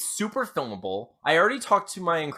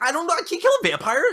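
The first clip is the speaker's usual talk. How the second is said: panicked high voice; faster than usual. Acted. Panicked victim voiced in retelling.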